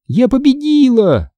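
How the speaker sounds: high, drawn-out victory cry; triumphant shout. A narrator's reading. Sister beating brother to the door, triumphant shout. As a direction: crow it out, stretched long. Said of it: "крикнула я".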